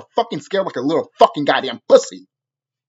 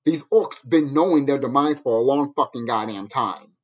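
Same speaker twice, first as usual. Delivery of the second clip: normal talking voice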